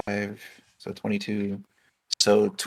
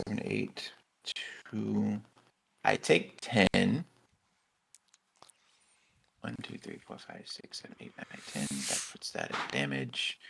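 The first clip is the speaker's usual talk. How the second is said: whispered counting; 7 dB quieter than usual. Real, not acted. Counting dice under breath.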